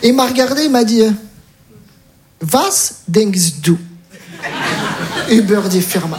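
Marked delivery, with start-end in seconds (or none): 2.5-6.2 slow, low German line; German question. Retold or imitated. Recruiter testing him in German, slow and low. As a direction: recruiter calmly calls the bluff in German.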